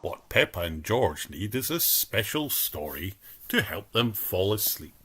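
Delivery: plain reading voice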